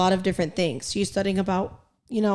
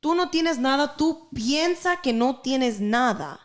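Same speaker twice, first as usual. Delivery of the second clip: mock dismissive father, pitched higher; dismissive dad; 4 semitones higher than usual. Quoting her dad dismissing her depression, higher mock voice. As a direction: imitate dad brushing her off, voice up high. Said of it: "So when he told me".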